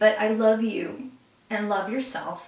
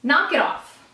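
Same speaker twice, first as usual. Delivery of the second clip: sharp, raised mock scold; card scolding; 5 semitones higher, 5 dB louder, slower than usual. Card telling the stuck viewer off, mock scold. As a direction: tell them off, half joking. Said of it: "And what it's saying to me is".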